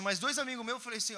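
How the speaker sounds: ordinary talk, nothing marked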